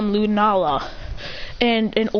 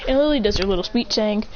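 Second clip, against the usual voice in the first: plain reading voice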